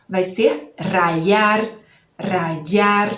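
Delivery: rayar slowly, with the y said as an i glide; y as i sound. Shown as a demonstration. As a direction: stretch rayar and soften the y. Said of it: "a pronúncia vai mudar".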